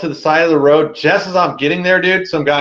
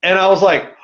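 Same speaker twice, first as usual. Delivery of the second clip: ordinary talk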